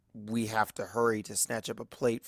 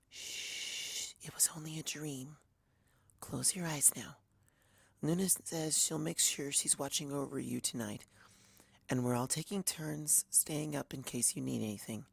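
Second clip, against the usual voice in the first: soft high whisper, barely voiced; soothing whisper; 3 semitones higher, 10 dB quieter than usual. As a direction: hush a friend back to sleep. Fluttershy soothes Twilight back to sleep.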